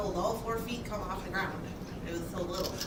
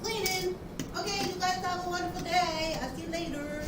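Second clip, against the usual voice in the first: higher-pitched send-off call; 10 semitones higher than usual. Real, not acted. Host to departing visitor, calling out. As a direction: call a high goodbye across the room.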